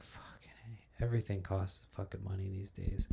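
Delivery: hushed exasperated whisper. Real, not acted. Vlogger to camera, muttering a frustrated whisper. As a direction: breathe it out under the breath.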